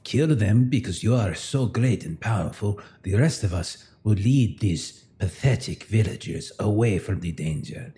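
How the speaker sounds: ordinary talk, nothing marked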